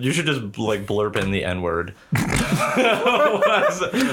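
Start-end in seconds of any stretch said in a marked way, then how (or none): 2.0-4.1 high, slow, laughing question. Real, not acted. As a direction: ask while cracking up. Laughing mid joke.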